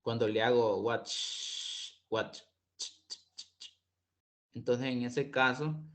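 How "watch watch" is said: "watch" ending in a voiceless ch; "watch" with a voiceless final sound; unvoiced ch in watch. Shown as a demonstration. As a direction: end "watch" with a voiceless ch, no buzz. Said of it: "mis cuerdas vocales no vibran".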